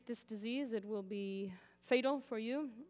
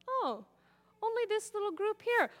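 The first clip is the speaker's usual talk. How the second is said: mock surprise; 9 semitones higher, 4 dB louder than usual. Feigned surprise at vaccine demo.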